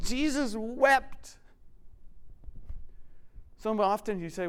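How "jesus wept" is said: slow emphatic. Shown as a demonstration. Quoting the verse with weight.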